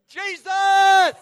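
sudden shouted cry of Jesus; loud exclamation. Retold or imitated. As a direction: yell it out like a fired-up churchgoer. Preacher crying out in church.

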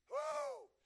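very high, loud woo; excited whoop. Real, not acted. Preacher thrilled at God's work, high whoop.

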